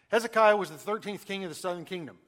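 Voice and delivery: the whole sentence in one quick run; quick and confident, all joined up; fast. Shown as a demonstration. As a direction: rattle it off smoothly without a break. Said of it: "you can say it fast".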